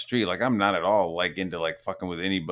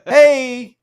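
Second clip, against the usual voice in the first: much higher put-on mocking voice; mock poster voice; 6 dB louder, slower than usual. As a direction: parody a clueless poster announcing trivia. Mocking people posting in chat.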